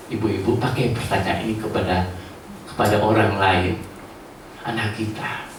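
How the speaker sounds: ordinary talk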